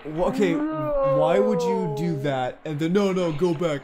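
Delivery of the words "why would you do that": dragged-out slow-motion words. Pretend. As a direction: stretch every word like a replay. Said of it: "Let's do it slow motion".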